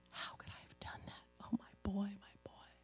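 whispered self-reproach, acted out; faint, grieving whisper; anguished whisper. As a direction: relive the guilt almost without voice. Acts out her anguish over son.

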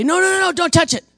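high, loud panicked plea; begging mock panic; panicked refusal. Satanists pleading with preacher about demons. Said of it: "they'd say".

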